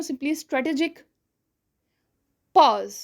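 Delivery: long pause before "pause", then "pause" set apart; strategic pause. Shown as a demonstration. Teacher demonstrating a pause around "pause" for students. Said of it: "पहले और बाद में थोड़ा सा pause दिया".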